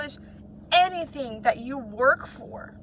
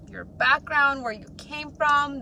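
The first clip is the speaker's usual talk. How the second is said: ordinary talk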